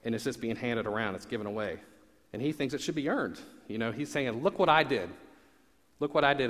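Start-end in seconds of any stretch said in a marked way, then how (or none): 4.4-6.5 resentful complaint. Retold or imitated. Older brother demanding credit.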